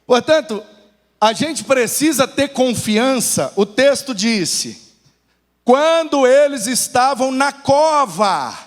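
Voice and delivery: slow, rising pulpit oratory; emphatic preaching. Real, not acted. Pastor urging the congregation to have confidence, slow forceful preaching. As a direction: drive each phrase home to the congregation, slowly.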